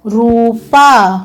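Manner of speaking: रूपा said very slowly and drawn out; रूपा stretched like dictation. Shown as a demonstration. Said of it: "dictation word for pupils".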